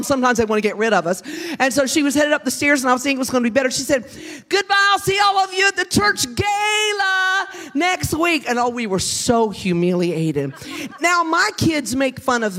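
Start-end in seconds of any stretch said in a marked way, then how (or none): 4.5-8.5 high, sustained singsong farewell; calling out goodbye. Retold or imitated. Mother heading upstairs to the kids' friends, calling out goodbye. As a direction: call it out high to the whole room.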